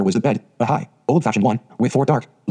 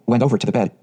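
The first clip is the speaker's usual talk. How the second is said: plain reading voice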